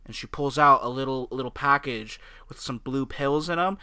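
normal talking voice

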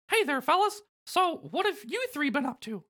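friendly falsetto mouse; Mickey. Acted. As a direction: greet them in a high, squeaky mouse voice. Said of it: "Mickey says".

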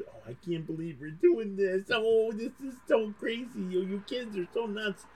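put-on high, amused old janitors; a hint of tired janitors shaking their heads; janitors' disbelief.

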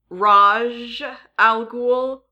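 "Raj al Ghul" with Raj drawn out as "rahzh"; variant pronunciation. Shown as a demonstration. Host offers listeners another option for the name, Raj as a slow "rahzh". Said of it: "how they want to pronounce".

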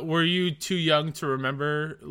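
ordinary talk

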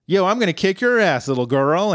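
high, fast cocky taunt; brash mock threat. Bender taunting finalist Tanya, brash mock threat. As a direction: imitate a swaggering trash-talker.

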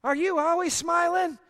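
high, loud, amazed lady in public; surprised lady. Retold or imitated. A lady in public asks the pastor, high and amazed. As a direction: play the stranger who is amazed at the smile. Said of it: "she says".

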